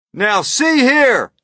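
high, drawn-out, full shout. A narrator's reading. Police chief hailing the creatures far above, shouting. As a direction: shout up as through cupped hands. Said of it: "shouted the chief of police".

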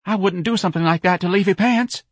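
high, piping protest; quivering denial. A narrator's reading. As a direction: plead loyalty in a nervous high voice. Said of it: "His lips were quivering".

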